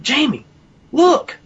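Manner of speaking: high-pitched imitated cry for attention; urgent amazed call. Friend calls the teller over to see his arm, amazed.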